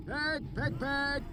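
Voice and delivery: slow, high call to pigs; a herdsman calling far through trees; distant calling. A narrator's reading. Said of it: "A voice came through the trees".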